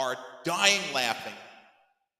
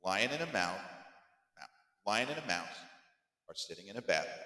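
soft, lower, patient dad spelling it out; slow walk-through; 4 semitones lower, 7 dB quieter, faster than usual. Retold or imitated. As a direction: explain gently in short phrases with pauses. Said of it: "Go real slow".

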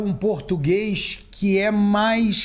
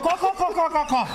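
high-pitched clucks on "cocô"; chicken clucking; 9 semitones higher than usual. Pretend. Joking that the baby cackled, high chicken clucking. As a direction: cluck the words like a hen. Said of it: "Cacare-, cacare-, cacarejou?".

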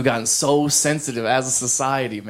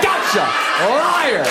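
triumphant accusation; 8 semitones higher, much slower than usual. Retold or imitated. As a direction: shout it like you caught him red-handed. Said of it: "You're like".

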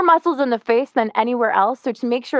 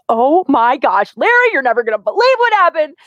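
excited gossip; 4 semitones higher, 5 dB louder than usual. Shown as a demonstration. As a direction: sell the big news. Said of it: "But if I'm like".

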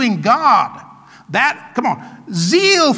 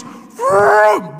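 imitated whoosh; 11 semitones higher than usual. Preacher acting out the javelin throw, imitated whoosh. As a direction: mimic the javelin whooshing through. Mimics javelin flying through two people.

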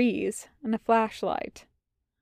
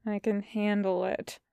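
soft, listless reply; dull; 7 dB quieter than usual. A narrator's reading. Wash answers Tucker's doubts, dully. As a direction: say it dully, with no fight left. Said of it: "Wash says dully".

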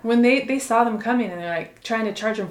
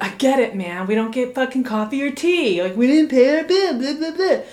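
snarky complaint; 5 semitones higher, 6 dB louder than usual. Retold or imitated. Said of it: "I was like".

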